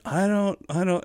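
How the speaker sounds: high, anxious stammer; flustered protest. Retold or imitated. Flustered singer being scolded.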